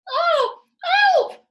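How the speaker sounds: very high, loud scream. Acted. Missus Twit screams for help, giddy and terrified. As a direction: cry for help at the top of her lungs. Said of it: "screamed Missus Twit".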